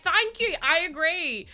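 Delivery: high-pitched retold vindication, loud at first; emphatic agreement. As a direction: exclaim it like finally being understood. Retells her grateful, vindicated agreement.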